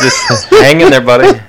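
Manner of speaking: laughing through the words; giggling high. Real, not acted. Teasing the laughing co-host.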